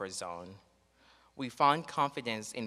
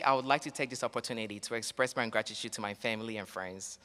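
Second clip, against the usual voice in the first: ordinary talk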